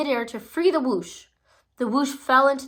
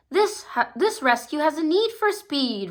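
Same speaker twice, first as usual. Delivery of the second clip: declaring leader; 6 semitones higher, 3 dB louder than usual. A narrator's reading. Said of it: "Ryder declared".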